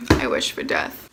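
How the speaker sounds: very low raspy whisper of doom; mock despair. Fed up with the story, low deathly whisper. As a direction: groan it like a dying ghoul. Deadpan despair after cough.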